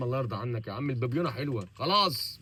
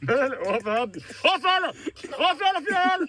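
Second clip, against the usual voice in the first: high, loud words breaking into laughter; laughing; 9 semitones higher, 7 dB louder than usual. Real, not acted. Host telling guest to stand still, cracking up. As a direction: scold him but crack up. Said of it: "انا بضحك انا".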